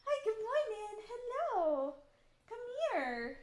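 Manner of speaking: put-on high, loud excited baby talk; morning dog greeting. Streamer showing how she greets Millie each morning, high gushing baby talk. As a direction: gush over the puppy at dawn.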